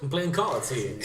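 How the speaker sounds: laughing attempt at a Staten Island accent; badly faked Staten Island wise guy. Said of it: "I'm from kind of Staten Island there".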